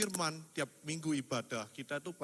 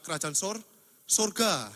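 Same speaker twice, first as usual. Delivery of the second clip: loud emphasis; 5 semitones higher, 7 dB louder, slower than usual. Real, not acted. Climax of preaching line.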